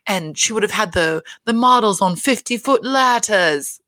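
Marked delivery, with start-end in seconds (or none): 1.4-3.8 slow mock-grand accent, r dropped in ladders; put-on accent, higher, drawing out fifty-foot ladders; Dorit's accent. Podcaster quoting a housewife's warehouse plan, put-on accent. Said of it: "Dorit is talking about how".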